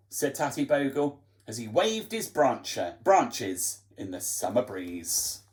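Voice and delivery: ordinary delivery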